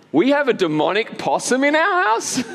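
high incredulous question; acted disbelief. Husband learning of the possum from his wife, comically incredulous. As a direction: pitch it up in comic astonishment.

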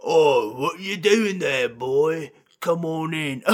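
loud, drawn-out character voice; big put-on voice, stretched words; in-character greeting. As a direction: boom out a hammy welcome. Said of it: "sometimes you'll be like".

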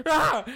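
loud startled scream. Acted. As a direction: scream in sudden fright. Sudden scream.

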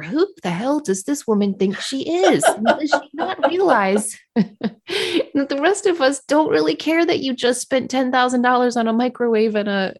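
laughing, exasperated rant. Retold or imitated. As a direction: vent it with a laugh. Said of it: "My thoughts were".

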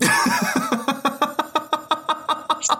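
can't hold the laugh in, break into high cackles; burst of high laughter. Real, not acted. Laughing at his own fight story with the host.

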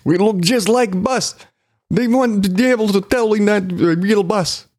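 lightly put-on Russian accent. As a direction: ham up a fake Russian accent. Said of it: "Let me get an offensive Russian accent ready".

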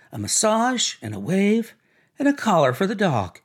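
affected woman's voice, mostly pitched high; high, gushing, self-important chatter; Myrtle showing off. Acted. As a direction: rattle off a shopping list grandly. Myrtle voiced, listing purchases.

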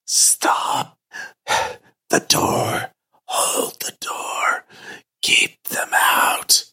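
slow, urgent phantom whisper; a dead voice begging in a whisper; ghostly whisper. Acted. Ghost warns the player to hold the door, whispered.

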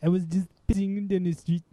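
mock Welsh accent. Joking co-host answers a greeting in a mock Welsh accent. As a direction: joke attempt at a Welsh accent. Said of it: "is that your Welsh accent again".

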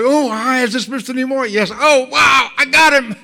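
mock giddy fan shrieking "I got him"; thrilled fan. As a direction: squeal in triumph at reaching the star. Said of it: "another female voice".

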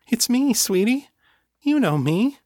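high-pitched nervous sweet-talk; anxious coaxing. A narrator's reading. Kate coaxing a growling dog, high and anxious. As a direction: coax gently in a high, worried voice.

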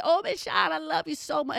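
put-on high, sweet little-kid gush. Kid gushing love to the teacher, high and sweet. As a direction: play the clingy child adoring her teacher.

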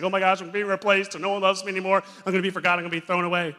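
high, loud anxious whine of a jealous toy; panicked jealous Woody. Retold or imitated. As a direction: squeal it out like a toy terrified of being replaced. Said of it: "he gets super jealous, and he's like".

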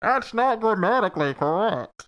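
hammed-up masked villain as schoolmaster; old English professor. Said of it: "sound like an old English professor".

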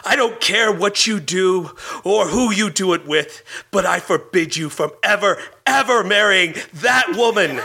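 high, emphatic, theatrical forbidding; indignant outburst. Acted. Woman forbids Peter to marry before storming out, indignant.

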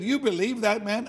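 high, loud incredulous question; retold angry challenge. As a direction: imitate someone indignantly challenging you. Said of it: "He got very angry".

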